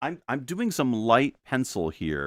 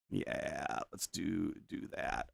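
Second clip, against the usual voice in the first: unvoiced whisper to himself; unvoiced muttered aside; 4 semitones lower, 11 dB quieter, slower than usual. Real, not acted. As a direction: whisper it while concentrating. Whispered aside while drawing.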